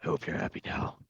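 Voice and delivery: gruff, voiceless rasp; low-energy gruff whisper; gruffy voice. Shown as a demonstration. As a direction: rasp it out like a brooding vigilante. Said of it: "I'm gonna do the gruffy voice".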